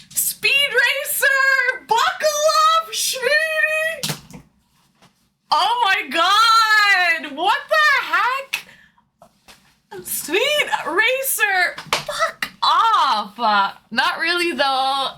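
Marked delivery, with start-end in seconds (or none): 0.1-4.4 high drawn-out sung cheer; excited sing-song shout. Real, not acted. Gasp at huge gift, sings. 5.5-13.4 high, slow, crying-out disbelief; overwhelmed squeal. Real, not acted. Overwhelmed by gifted subs.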